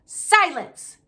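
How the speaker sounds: high, loud furious yell. Acted. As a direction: cut him off with a bellow. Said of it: "yelled Uncle Vernon".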